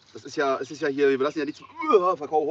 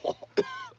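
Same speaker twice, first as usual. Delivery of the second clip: high squeaky laugh; 8 dB quieter than usual. Real, not acted. Laughing at the mess.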